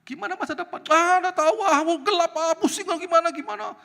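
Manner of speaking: high, loud hopeless fretting; mock panicky grumbling; pessimistic complainer. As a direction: act out someone wailing about the future. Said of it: "ngomongannya pesimis saja".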